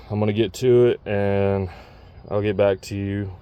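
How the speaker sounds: normal talking voice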